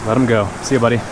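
ordinary delivery

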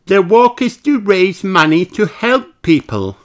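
a bright piglet explaining; Peppa's voice. Acted. Peppa explaining the walk to Granny, high and chirpy. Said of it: "explains Peppa".

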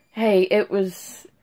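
usual speaking voice

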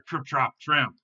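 loud, stomping beats; heavier hoofbeats. A narrator's reading. Heavier goat tramps over the bridge, loud stomping words. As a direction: punch each word like a heavy hoof. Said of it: "It sounded heavier".

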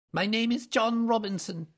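high, loud, nervous claim; evasive little man. A narrator's reading. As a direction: offer a false name uneasily. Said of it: "with a sidelong glance".

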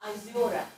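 Azora with unstressed O sounding like A; Azora pre-stress. Shown as a demonstration. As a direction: say Azora reducing the first O. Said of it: "Pre-stress".

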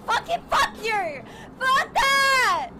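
high cursing shouts, near tears; raging gamer. Real, not acted. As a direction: scream curses at the game. Raging after being ambushed.

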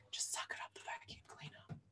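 fast secret whisper; whispering a naughty shortcut; whispered aside. Acted. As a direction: confide it under your breath. Conspiratorial aside.

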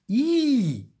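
high, loud squeal of disgust; little girl ghost revolted; disgusted cry. A narrator's reading. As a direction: recoil with a long piercing "ew". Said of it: "rief Lucy angewidert".

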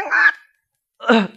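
high, strangled death cries; dying screams. Acted. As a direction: scream out the last breaths. Poisoned prince dying scream. (臨死慘叫)

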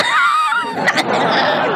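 squealing scream of a laugh; rising high cackle; high shrieking laugh. Real, not acted. Laughing at rival.

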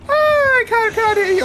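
high, teasing sing-out; playfully high-pitched feigned deafness; mock not hearing. Killer feigns not hearing victim.

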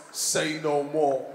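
slow, low youth voice; lightly imitated youth, slow and low; mock street youth. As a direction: drag it out low like a cool youth. Retelling a youth's cryptic reply.

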